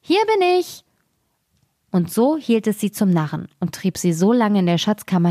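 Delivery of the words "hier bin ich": much higher and drawn out, cheeky; a tiny thief taunting the guards slowly; teasing tiny call. A narrator's reading. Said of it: "und rief". (die Wachen langsam verspotten, hoch und frech)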